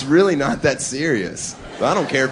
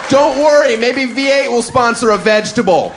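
much higher, loud jeering yell; gloating shout; 10 semitones higher, 4 dB louder than usual. Acted. Fan in the stands screaming gleeful taunts at player. Comic yelling at injured player.

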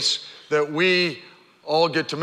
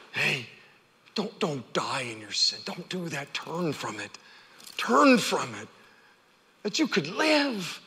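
soft plea; 4 semitones lower, 7 dB quieter than usual. Real, not acted. Gentle personal plea.

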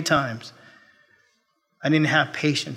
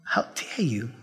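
stern rebuke; 5 dB quieter than usual. Retold or imitated. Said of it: "It's as if the Lord said".

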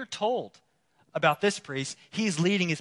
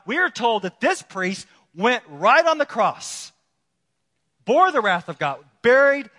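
raised, emphatic preacher's delivery; forceful preaching; 6 semitones higher, 9 dB louder than usual. Acted. Preacher telling the church of the priest who never retreats, forceful preaching. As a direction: drive each phrase home like a preacher in full flow.

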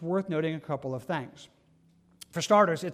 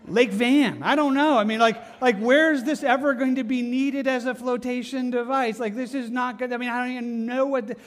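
loud, higher, exasperated bafflement; incredulous rant; 5 semitones higher, 6 dB louder than usual. Acted. Passenger questioning the flotation device, incredulous. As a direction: protest in loud disbelief.

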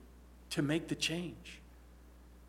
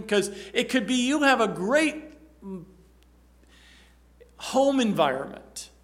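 forceful preaching; 7 semitones higher, 10 dB louder than usual. Acted. Pastor urging the congregation to put on love, forcefully preaching. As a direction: drive the point home from the pulpit.

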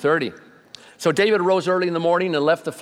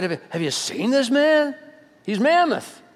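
awed soldiers; 9 semitones higher than usual. Retold or imitated. Frightened soldiers describing Goliath.

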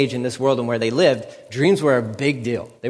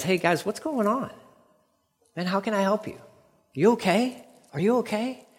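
higher, softer, caring check-in; gentle concern; 7 semitones higher, 4 dB quieter than usual. Acted. Joseph softly asking the downcast prisoners if they're okay.

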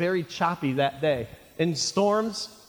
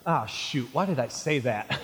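lower, muted grumble to himself; regretful Peter; 6 semitones lower, 3 dB quieter than usual. Acted. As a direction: play mild second thoughts under the breath. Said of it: "imagine maybe Peter's like".

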